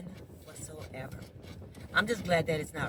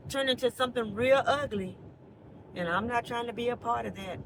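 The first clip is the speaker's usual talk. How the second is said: usual speaking voice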